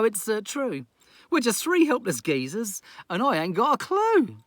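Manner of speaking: captain moaning about the chores; Festus, dismayed. Acted. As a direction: wail about being left alone. Festus lamenting, voiced.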